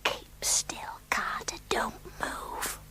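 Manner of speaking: soft urgent whisper. Acted. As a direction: hush him so nobody hears. Hiding from uncle.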